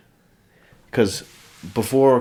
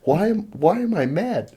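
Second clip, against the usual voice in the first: mock bewildered; 10 semitones higher than usual. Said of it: "It's like".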